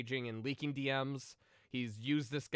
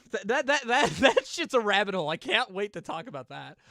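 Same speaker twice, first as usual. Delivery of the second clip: excited rant; 7 semitones higher, 10 dB louder than usual. Real, not acted. Excited rant about rabbit hole.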